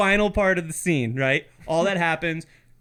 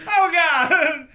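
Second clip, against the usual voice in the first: mock terrified gasp; 11 semitones higher, 7 dB louder than usual. Scared camper reacting, acted.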